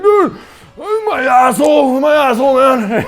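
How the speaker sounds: high, loud slow yelling in pain; screaming about the injury to a buddy; pained shout. Retold or imitated. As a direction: bellow it like you're hurt bad. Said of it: "I'm like".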